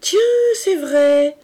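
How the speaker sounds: high, loud, slow mock-innocent exclamation; naive girl. A narrator's reading. Berthe feigning innocence to her uncle, high and loud. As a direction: play the innocent, wide-eyed. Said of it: "ajouta Berthe d'un air naïf".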